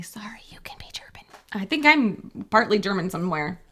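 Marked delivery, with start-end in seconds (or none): 0.0-1.2 hushed, breathy apology; mock whispered apology. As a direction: whisper it like a secret.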